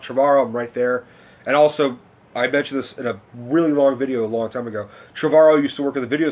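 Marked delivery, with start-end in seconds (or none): none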